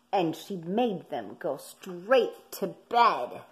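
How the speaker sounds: ordinary delivery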